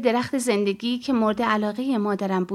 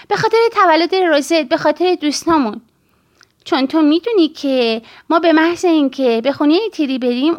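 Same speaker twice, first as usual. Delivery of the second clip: lightly voiced insistent child, louder; pleading child; 4 semitones higher, 6 dB louder than usual. Child urging mother to celebrate Christmas here, lightly voiced pleading. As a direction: plead like a child making a case.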